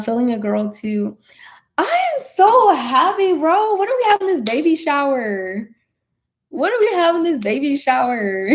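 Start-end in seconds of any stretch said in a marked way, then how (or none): 1.8-8.5 overjoyed. Real, not acted. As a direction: gush in a high voice, stretching the words. Celebrating friend's pregnancy news.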